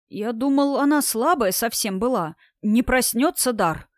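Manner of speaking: usual speaking voice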